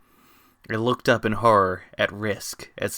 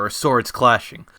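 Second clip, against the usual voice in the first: usual speaking voice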